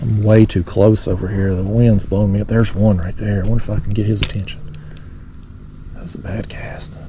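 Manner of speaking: hushed, murmured commentary while stalking fish; talking real low. Real, not acted. Fishing close over the bass, hushed commentary to viewers. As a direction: stay soft and low, creeping up on the school. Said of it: "I don't know why I'm still talking real low".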